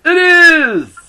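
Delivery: very high, loud playful squeal; gleeful squeal. Pretend. Host agrees it's her favorite thing ever, gleeful squeal. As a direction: squeal it in mock delight. Mock-excited echo of co-host.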